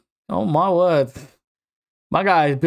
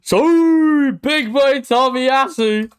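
gloating; 7 semitones higher, much slower than usual. Real, not acted. Gloating over pull.

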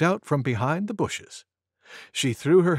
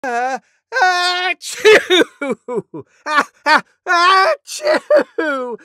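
very high, loud double mock achoo; huge disgusting sneeze; 8 dB louder, much slower than usual. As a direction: explode in drawn-out, messy pretend sneezes. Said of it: "huge, disgusting sneeze like this".

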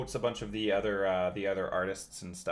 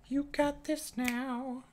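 high cheering voice; much slower than usual. Real, not acted.

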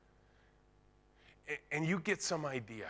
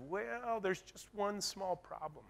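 hesitant employee; 6 semitones higher, 8 dB quieter, slower than usual. Retold or imitated. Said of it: "And she said".

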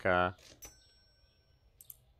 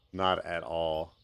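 ordinary talk

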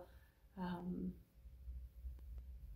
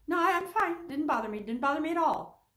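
higher, louder insistence that nothing's wrong; defensive denial; 8 semitones higher, 6 dB louder than usual. Retold or imitated. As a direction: protest too much, pitched up. Mimicking a man insisting he's fine.

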